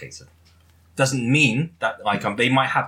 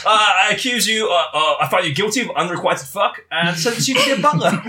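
mock judge; 6 semitones higher, 3 dB louder than usual. Mock courtroom sentencing.

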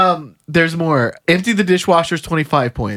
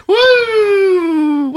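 put-on high, wailing old-lady whoop; grandma whooping; 3 dB louder than usual. Joker carrying on the grandma bit, high whooping. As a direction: whoop shrilly like a carried-away grandma. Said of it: "The grandma's going at it again".

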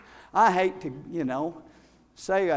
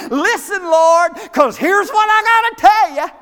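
much higher and louder, pushy listen Lord; mock demanding prayer; 11 semitones higher, 9 dB louder than usual. Preacher mocking the congregation's prayers, loud and bossy. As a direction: order God to listen, hammed-up. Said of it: "We say".

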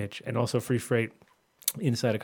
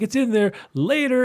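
high, loud evasive reply; mock sly friend; 14 semitones higher, 7 dB louder than usual. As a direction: squeak it out like a dodge. Retold friend's dodge.

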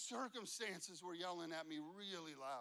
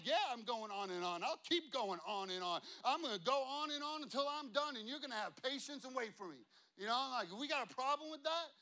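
loud, worked-up mock argument; defiant retort; 5 semitones higher, 7 dB louder than usual. Pastor retorting to an impatient customer, loud defiant comeback. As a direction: push back hard, building higher and staying loud.

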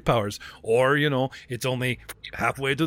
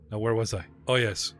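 ordinary talk

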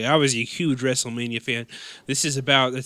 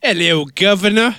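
mock British accent; 7 semitones higher, 7 dB louder than usual.

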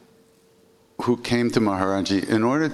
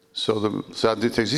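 usual speaking voice

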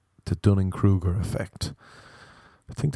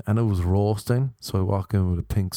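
ordinary talk, nothing marked

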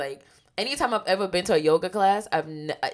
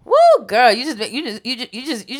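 excited; 9 semitones higher, 13 dB louder than usual. Real, not acted. Excited reaction to calm voice.

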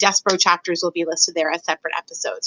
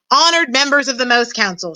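mild, higher-pitched announcing shout; 5 semitones higher, 3 dB louder than usual. A narrator's reading. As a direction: hail the council formally. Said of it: "shouted Furlow".

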